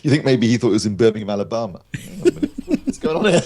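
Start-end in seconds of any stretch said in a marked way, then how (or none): 1.9-3.4 high, bewildered American voice; higher, louder mock confusion of a lost filmmaker; baffled Spielberg. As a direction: sound utterly lost in a strange city. Spielberg lost in Birmingham joke.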